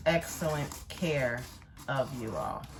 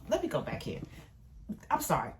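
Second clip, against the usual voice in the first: ordinary talk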